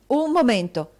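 "Un momento" detto forte e di colpo, più alto; esclamato in fretta. Acted. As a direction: interrompe di scatto, deciso. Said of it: "esclamò Nicola in fretta". (Nicola la interrompe bruscamente, a voce alta)

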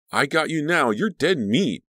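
high, loud playful taunt; taunting threat. A narrator's reading. Sibling hunting Danielle in water fight, taunting. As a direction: call out triumphantly to your prey.

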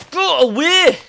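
loud, high Scottish yell; Scottish accent. Real, not acted. Player yelling at vines, Scottish accent. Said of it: "I don't know why I end up going Scottish".

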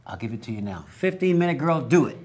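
ordinary delivery

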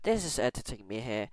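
normal talking voice

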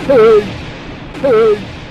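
sharp startled yell; shout. Real, not acted. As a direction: yell out at the screen. Game jump scare call out.

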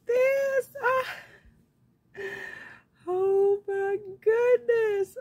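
high, loud, slow cry of delight; nearly tearful shout of joy; overjoyed. Real, not acted. Seeing the friend's handmade gift, crying out in joy.

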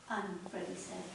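ordinary delivery

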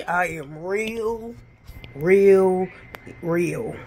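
insistent, deliberate repetition of "real"; emphatic real. Real, not acted. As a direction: drive home that she's real. Insisting on her realness.

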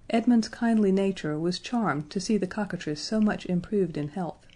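ordinary delivery

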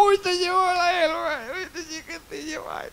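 put-on the drunk marvels, voice jumping up; drunk amazement. Drunk stares at the dog, squealing in amazement.

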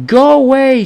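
high, loud angry shout. Acted. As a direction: yell it through the door. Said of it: "shouted the voice".

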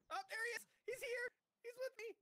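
high, loud frightened calls; alarmed shout. Real, not acted. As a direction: cry out in alarm. Enemy appears next to him.

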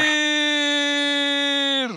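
very high, loud, held cry; drawn-out full-force yell; long roar. Shown as a demonstration. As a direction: stretch the word into one sustained roar. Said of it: "Vamos a rugir juntos".

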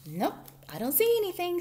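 high, quick mouse voice; searching mouse. A narrator's reading. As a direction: report briskly as a little mouse. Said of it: "said Mouse from under the bed".

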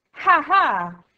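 loud, high delighted cackle; gleeful laugh. A narrator's reading. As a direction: laugh in triumph. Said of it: "rise contento".

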